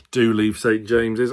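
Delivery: normal talking voice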